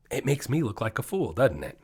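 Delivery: ordinary delivery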